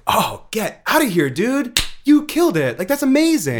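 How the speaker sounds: delighted disbelief, voice rising right up; amazed disbelief. Retold or imitated. Actor recalls his reaction to Chekhov's title trick, amazed and thrilled. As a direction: pitch climbing high with delight.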